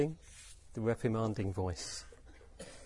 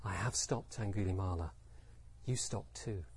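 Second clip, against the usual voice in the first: soft, low and inward; really quiet; 6 semitones lower, 9 dB quieter than usual. Shown as a demonstration. Buddha reflecting his own non-violence, very quiet. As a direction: say it very softly, from inner stillness. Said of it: "maybe he said it really quietly".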